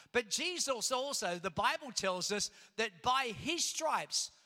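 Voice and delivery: fervent pastor in full flow; full preaching. Acted. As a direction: preach it out to the congregation.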